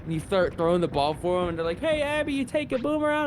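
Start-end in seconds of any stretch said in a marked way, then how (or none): 1.8-3.2 high-pitched imitated colleague; chirpy character voice. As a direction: ask brightly, pitched well up. Acted game characters chatting about dog.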